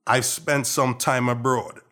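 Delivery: not-quite-full Trinidadian accent, conversational. Real, not acted. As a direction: speak in a softened Trinidadian accent. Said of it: "with this Trinidadian accent".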